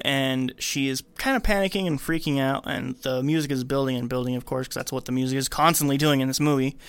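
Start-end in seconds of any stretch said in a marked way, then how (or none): none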